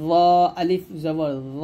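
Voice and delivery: ল as a heavy back-coloured sound, held long; thick, full letter. Shown as a demonstration. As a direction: fill the mouth and stretch ল. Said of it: "মোটা করে উচ্চারণ করতে হবে".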